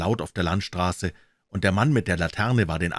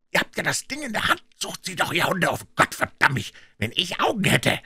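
high, strained scolding; angry tirade; 12 semitones higher than usual. A narrator's reading. Puh cursing his idle men, angry tirade. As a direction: berate the gang and push them on.

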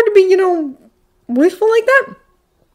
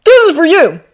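put-on high, loud cheerful kid; 9 semitones higher, 7 dB louder than usual. A narrator's reading. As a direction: present the gift brightly, voice up. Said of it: "with a cheerful".

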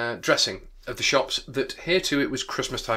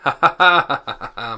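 laughing; 3 semitones higher, 13 dB louder than usual. Real, not acted. Teacher reaching a favourite bit of symbolism, laughing. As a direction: laugh out in open delight.

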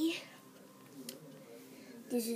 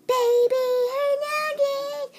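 high, loud, drawn-out announcement; announcer shout; 10 dB louder, slower than usual. Acted. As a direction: call out the name like a host. Announcing own name.

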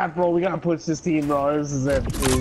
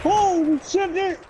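being chased; 9 semitones higher than usual. Real, not acted.

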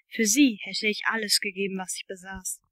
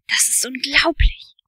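breathless whispered gasp, slow; 3 semitones higher, 6 dB louder than usual. A narrator's reading. Steve gasping to his friend, breathless whisper. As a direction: gasp it out in awe.

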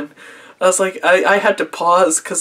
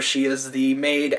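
ordinary talk, nothing marked

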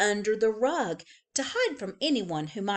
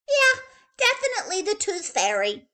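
put-on high, louder little-girl voice; childish insistence; 12 semitones higher, 6 dB louder than usual. A narrator's reading. Little girl to her siblings, high and insistent. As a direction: sell the fib with a squeak.

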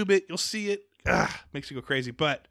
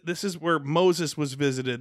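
ordinary talk, nothing marked

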